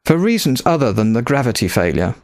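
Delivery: ordinary delivery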